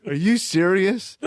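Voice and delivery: a half-shouted, slow, stunned "are you serious"; like you can't believe what you're seeing; retold disbelief. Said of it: "He's like".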